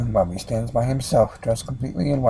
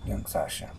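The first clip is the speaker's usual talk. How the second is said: ordinary delivery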